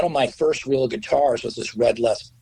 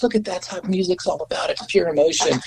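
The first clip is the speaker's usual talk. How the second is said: higher-pitched, mildly moved mom; emotional mom, imitated; 7 semitones higher than usual. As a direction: imitate a mother moved by the music. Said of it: "my mom used to get all emotional".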